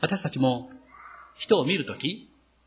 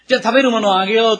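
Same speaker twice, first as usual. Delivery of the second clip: God's generous offer; 7 semitones higher, 7 dB louder, much faster than usual. Retold or imitated. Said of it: "と言って".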